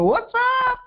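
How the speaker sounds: excited greeting. Retold or imitated. Answering a favourite client's call, fired up. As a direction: burst out happy to hear from them.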